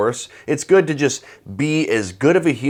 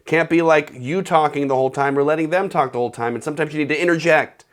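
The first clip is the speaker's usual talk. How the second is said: normal talking voice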